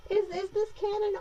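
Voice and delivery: high, puzzled squeak of a question; mock-confused high-pitched wondering; confused inner voice. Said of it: "So I'm like".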